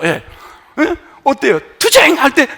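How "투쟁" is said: 투쟁 barked high and loud; forceful 투쟁. Shown as a demonstration. Pastor demonstrating the angry word 투쟁 to the congregation, forceful. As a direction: punch out 투쟁 with a tight mouth. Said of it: "이렇게 돼".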